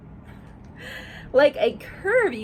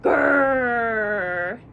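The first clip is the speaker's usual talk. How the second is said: growl; 2 semitones lower, 4 dB louder than usual. Acted. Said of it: "strange creature".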